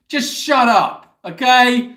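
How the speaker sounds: loud, slow, scolding shout; mock shout. Real, not acted. Shouting at a friend who called herself old, slow and loud. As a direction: yell it at her, drawn out.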